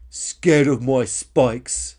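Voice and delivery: very slow, loud disbelief; slow indignant shout. Acted. Dinosaur repeats the insult in disbelief, slow loud shout. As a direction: drag out each word, outraged. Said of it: "shouted Karen".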